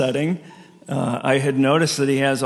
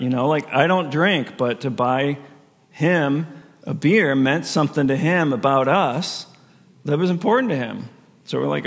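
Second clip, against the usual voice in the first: usual speaking voice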